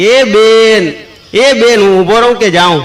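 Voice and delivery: loud high call across the street; old man calling out loudly. Retold or imitated. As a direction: shout after the ladies to wait. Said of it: "કાકાએ રાડ પાડી કે".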